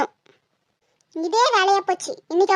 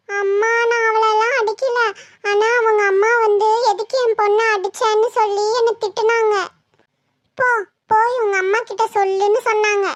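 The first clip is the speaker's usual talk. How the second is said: tattle to mum as if crying; weepy child voice in a sing-song whine; tearful child. Acted. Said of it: "முதல்ல அழுகையை நிறுத்து".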